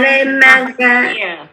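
les maga- split into slow, separate syllables; slow syllable drill. Shown as a demonstration. Teacher helps a stuck student, slow syllable by syllable. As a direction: break it apart slowly for the student. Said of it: "teacher breaks word for student".

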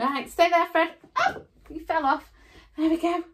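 normal talking voice

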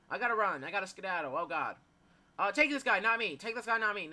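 panicked fleeing. Real, not acted. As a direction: blurt it while running from danger. Fleeing the game's monster.

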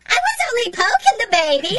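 very high loud shriek; high creature shriek. A narrator's reading. Taped-up robot shrieking at Walt, high loud scream. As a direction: scream in panic.

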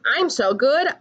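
ordinary delivery

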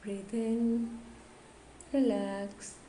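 slow, measured yoga nidra guidance; guided meditation. Acted. Yoga teacher guiding listeners to relax the whole body, guided meditation. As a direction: lead them gently through the body, phrase by phrase with long pauses.

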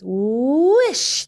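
put-on little girl reading the sand; girl reading a word. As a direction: sound out the word like a delighted girl. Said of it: "the little girl read".

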